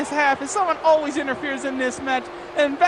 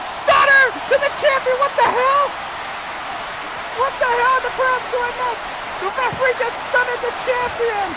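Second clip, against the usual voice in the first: very high-pitched, breathless-with-excitement match call; frantic play-by-play; 12 semitones higher, 4 dB louder than usual. Real, not acted. Commentator watches the champion get stunned and tap out, frantic.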